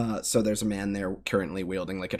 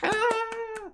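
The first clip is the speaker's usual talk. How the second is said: crowd cheer. Acted.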